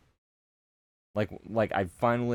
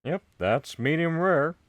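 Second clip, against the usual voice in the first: a higher-pitched, louder Texan dad voice; lightly done Southern dad, sure of his grilling; a quoted Texan cartoon dad voice; Hank Hill; 9 semitones higher, 4 dB louder than usual. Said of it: "some of the only quotes I know".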